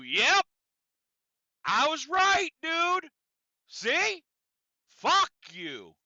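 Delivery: high, loud gloating; smug inner voice. Retold or imitated. His mind answers the supervisor, loud smug gloating. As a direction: crow it like you won the argument. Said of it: "my mind goes to".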